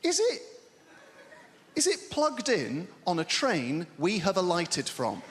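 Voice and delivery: squeaky, high, pestering questions; high nagging partner voice. Acted. Partner pestering about the lost charger, high-pitched and eager. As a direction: pipe up shrill and eager with each guess.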